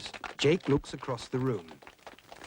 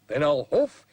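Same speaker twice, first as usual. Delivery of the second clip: threatening wolf; 7 semitones higher than usual. Acted. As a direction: build the threat word by word. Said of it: "says Harry".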